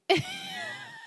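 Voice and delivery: squealing high laugh; high shrieking laugh. Real, not acted. Laughing at Philly point.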